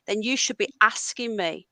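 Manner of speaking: normal talking voice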